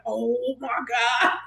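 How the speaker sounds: slow, stretched-out mock horror; horrified dismay. Hairdresser just hit Prince with curling iron, aghast. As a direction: drag the words out, aghast.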